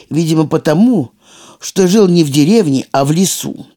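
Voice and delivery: usual speaking voice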